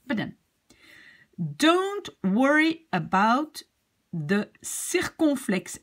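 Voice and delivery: ordinary talk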